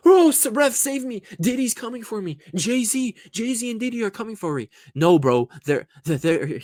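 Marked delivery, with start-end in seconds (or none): 0.1-4.7 much higher, whiny pleading to the ref; hammed-up scared diver begging for help; mock panicked player. Mimicking [name] pleading with the ref in fright. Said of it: "he was acting like [name] was running for him".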